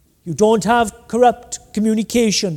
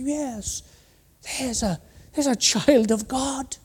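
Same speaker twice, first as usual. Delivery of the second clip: much softer, half-whispered awe; hushed mock admiration; 3 semitones higher, 8 dB quieter than usual. Onlookers mocking a pious man, hushed and mock reverent. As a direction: murmur it like impressed neighbours.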